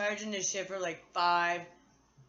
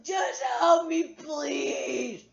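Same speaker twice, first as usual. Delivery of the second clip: hammed-up teary begging, breaking high; mock crying plea; 8 semitones higher, 4 dB louder, slower than usual. Begging her kids to help, mock crying. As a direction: beg through fake tears.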